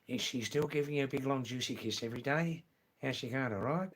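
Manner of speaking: quick, run-together Australian question; original Australian accent. Shown as a demonstration. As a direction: rattle it off in broad Australian. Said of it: "In original Australian".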